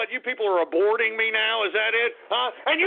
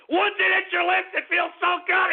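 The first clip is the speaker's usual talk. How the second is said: ordinary delivery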